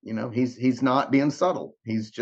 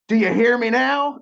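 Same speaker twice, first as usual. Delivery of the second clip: high, loud mock demand; forceful demand; 9 semitones higher, 5 dB louder than usual. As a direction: act out an insistent, piercing challenge. Acting out a forceful divine demand.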